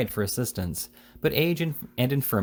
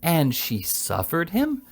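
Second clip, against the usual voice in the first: high, slow, indignant question; outraged exclamation; 6 semitones higher, slower than usual. A narrator's reading. The old lady, scandalised, questions the fat boy in outrage. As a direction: stretch it out in scandalised disbelief. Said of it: "exclaimed the old lady".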